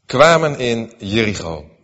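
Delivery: measured, solemn Bible reading; scripture reading. Acted. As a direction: read the passage to the congregation with weight. Bible reading aloud in church. (plechtige schriftlezing)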